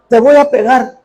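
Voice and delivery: amenaza de padre, más aguda y fuerte; padre amenazante. Retold or imitated. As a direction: amenaza al niño en broma. (el padre amenaza al niño, en broma)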